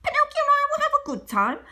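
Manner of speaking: high, quick cricket voice; high cheerful cricket; Jiminy Cricket. Acted. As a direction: chirp it fast. Jiminy answers the fairy.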